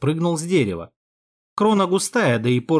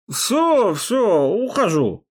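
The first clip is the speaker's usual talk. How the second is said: put-on drunk voice; 6 semitones higher, much slower than usual. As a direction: babble like a scared drunk. Hero still playing drunk.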